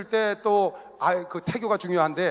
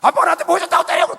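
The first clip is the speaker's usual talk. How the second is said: much higher and louder, a son's furious protest; teen's angry yell; 12 semitones higher, 8 dB louder than usual. Retold or imitated. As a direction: scream it back at your father. Teen son yelling at father.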